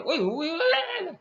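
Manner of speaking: hammed-up rude yell; mock snapping shout. Shown as a demonstration. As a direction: bark it like scolding a friend. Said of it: "macam nak tengking je".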